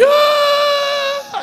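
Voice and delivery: imitated little girl's howl; long, high mock cry; child's wail. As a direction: wail like a toddler reaching for dad. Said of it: "fez com os bracinhos assim".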